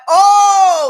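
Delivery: high, loud excited "Oh". Retold or imitated. Friends hyped to hear she's a lawyer, loud excited oh. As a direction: gasp it out like they just found a lawyer friend.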